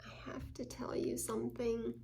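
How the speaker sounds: hushed whisper of a confession; child confessing to a friend, whispered; confiding whisper. Acted. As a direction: whisper it like a secret. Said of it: "Honest means".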